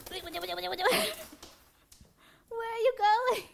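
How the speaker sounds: alarmed high-pitched calling out; startled calling after the bird. Real, not acted. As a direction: chase her with your voice. Bird walks away suddenly.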